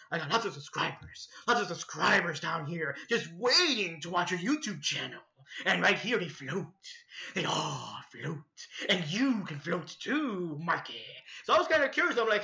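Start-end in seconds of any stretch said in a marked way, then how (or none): 0.1-11.0 Pennywise. Retold or imitated. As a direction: stretch the words out to lure him. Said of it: "I'm Pennywise the Dancing Clown".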